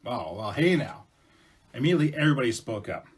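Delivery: usual speaking voice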